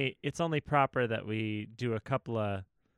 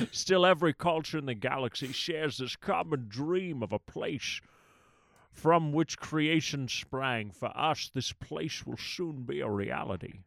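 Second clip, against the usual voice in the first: Connery voice. Pretend. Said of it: "Sybok a la Connery".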